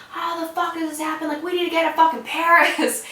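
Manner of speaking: high-pitched retold outburst; brother's outraged yelling. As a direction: imitate an outraged brother hollering from the back. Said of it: "hear my brother in the back".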